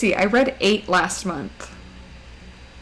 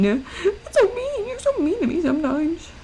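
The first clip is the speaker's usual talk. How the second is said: squeaky mouse voice; 8 semitones higher than usual. Pretend. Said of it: "I sound a little bit like a mouse".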